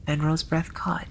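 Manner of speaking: ordinary delivery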